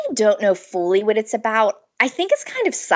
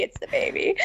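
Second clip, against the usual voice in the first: hushed whisper, higher; whispered aside; 7 dB quieter than usual. Real, not acted. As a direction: whisper it under your breath. Repeats the line as an aside.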